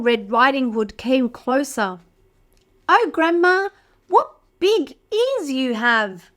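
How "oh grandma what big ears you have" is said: put-on higher, wondering girl voice; little girl. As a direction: sound amazed and innocent.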